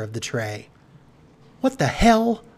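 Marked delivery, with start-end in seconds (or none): 1.6-2.4 high, loud exclamation; outraged cry. A narrator's reading. Marcy Marcy finding no food on tray, crying out. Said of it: "cried Marcy Marcy".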